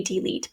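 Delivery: "delete" pronounced dee-LEET; long E in both syllables of "delete". Shown as a demonstration. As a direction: open "delete" on a full "dee". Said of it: "say that as a schwa sound".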